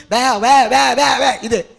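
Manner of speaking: loud repeated animal bleats; stabbed boar squealing. Retold or imitated. Preacher shows congregation the stabbed boar, loud animal cries. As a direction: bawl like a wounded wild pig. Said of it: "అడవి పంది కత్తిపోటు దిగింది".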